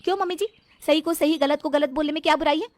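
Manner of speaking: high, loud righteous answer; firm insistence. Acted. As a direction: stand her ground to mother-in-law. Mona defends speaking up.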